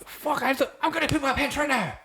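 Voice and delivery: put-on very high squeaky child voice; mock kid whine. Cohost jokes he needs the toilet, squeaky child voice. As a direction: pipe it up like a panicking toddler. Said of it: "mimics the kid voice".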